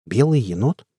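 short questioning echo, rising at the end; puzzled question. A narrator's reading. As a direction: ask it briefly, mildly puzzled. First take of repeated surprised question. (недоумённый вопрос)

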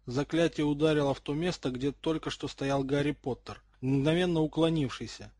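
ordinary delivery, nothing marked

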